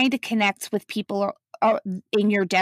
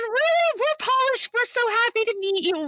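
much higher, bubbling with excitement; delighted surprise. Retold or imitated. Mother and daughter greeting the Polish waiter, high excited delight. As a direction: squeal with joy at meeting a fellow Pole.

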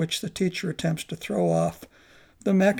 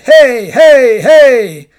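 loud, higher shouting; yelling; 5 semitones higher, 18 dB louder, much slower than usual. Acted. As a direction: shout to rouse the monk. Calling out at the door.

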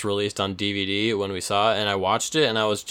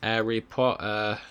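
mock accent; 3 dB quieter, slower than usual. Mock voicing the name.

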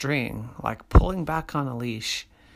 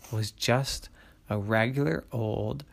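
usual speaking voice

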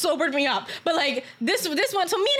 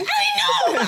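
high squealed shout with laughter; exclaimed agreement; 4 dB louder than usual. Real, not acted. Agreeing with co-host it escalated, excited shout. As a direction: burst out in high, delighted agreement.

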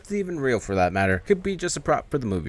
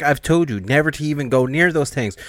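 angry; 6 semitones higher, 5 dB louder than usual. Retold or imitated. Bert scolding the workers.

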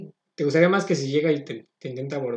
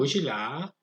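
"bushila" with a long-drawn final la; stretched ending; 3 semitones lower, 8 dB quieter, much slower than usual. Shown as a demonstration. Showing how Chinese speakers stretch words, drawn-out "bushila". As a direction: draw out the last vowel of "bushila". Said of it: "Como que extienden mucho".

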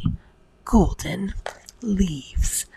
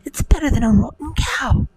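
put-on high, eager little boy; excited boy voice; 8 semitones higher than usual. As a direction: crow it triumphantly. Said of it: "Young Jack cried".

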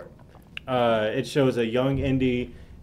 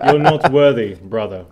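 loud heroic declaration; 2 semitones lower, 8 dB louder than usual. Retold or imitated. Quoting a Thor scene.

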